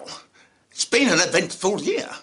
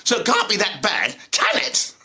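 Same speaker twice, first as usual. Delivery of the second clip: ordinary talk, nothing marked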